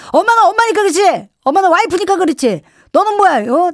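high, emphatic mock telling-off; indignant mother. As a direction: fire back like an offended mum. Mother snapping back in acted quarrel.

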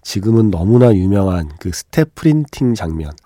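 ordinary talk, nothing marked

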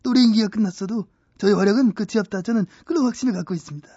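fast talking. Acted. Avatar rattles off lines fast.

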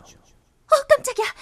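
high startled yelp. Acted. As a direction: jump out of your skin. Startled by voice from shadow.

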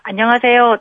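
ordinary talk, nothing marked

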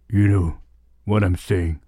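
slow, low-pitched take on a soul singer. Acted. DJ tries out the singer's voice, low attempted impression. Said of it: "하려는데 안 되네".

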